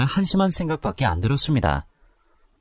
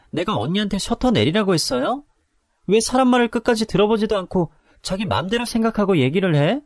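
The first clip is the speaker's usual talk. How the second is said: higher, snappy retort to the scolding; hostile; 8 semitones higher than usual. Acted. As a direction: bristle back at her, much higher. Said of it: "말이 조금 험악해지더라고요". (험악해진 말투)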